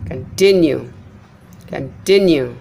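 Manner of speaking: continue as kun-TIN-you, low start; middle-syllable stress. Shown as a demonstration. Teacher showing unstressed prefix, continue stressed on TIN. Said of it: "Continue is trust".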